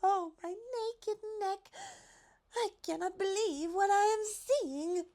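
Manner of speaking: high, slow, amazed giraffe voice; a giraffe overwhelmed with joy; high breathless disbelief; astonished giraffe. A narrator's reading. Giraffe character voice.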